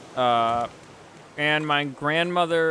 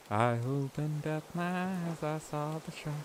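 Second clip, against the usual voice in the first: mock ominous hush; 8 dB quieter than usual. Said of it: "Yes, I just did a joke".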